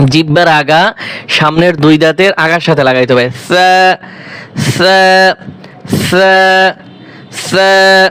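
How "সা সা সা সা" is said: সা with the tongue tip on the front teeth, soft. Shown as a demonstration. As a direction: say সা gently, one alif long. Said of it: "হরফটা নরম করে উচ্চারণ করতে হবে". (নরম করে সা উচ্চারণ)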